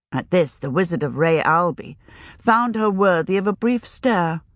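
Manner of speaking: ordinary delivery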